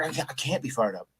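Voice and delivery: understated reluctant sadness; imitating sad refusal. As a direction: retell a deflated refusal, dropping the volume. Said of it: "I'm sad right now".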